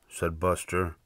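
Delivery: ordinary delivery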